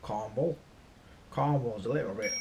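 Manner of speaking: put-on rural Cornwall accent; Cornish accent. Shown as a demonstration. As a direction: talk like a Cornish local. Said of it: "you go a bit further down into".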